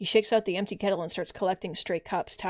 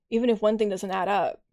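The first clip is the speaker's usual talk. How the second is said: normal talking voice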